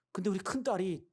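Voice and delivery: ordinary delivery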